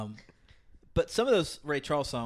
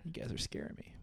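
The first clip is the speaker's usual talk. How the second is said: whisper; 14 dB quieter than usual. Real, not acted. Scared reaction to creepy eye talk.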